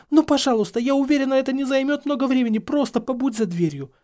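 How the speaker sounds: high, urgent pleading; imploring. A narrator's reading. Hermione begging Harry to wait outside, imploring. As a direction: beg him to go along with it. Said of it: "взмолилась Гермиона".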